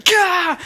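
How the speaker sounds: high, loud mock yelp of fright; hammed-up startled cry. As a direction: yelp like you've just been jumped. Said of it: "and they go".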